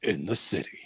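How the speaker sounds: low, slow movie trailer announcer; deep, dramatic trailer narration with weighty pauses. Retold or imitated. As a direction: intone it like an action trailer voice-over. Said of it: "It's like the movie voice".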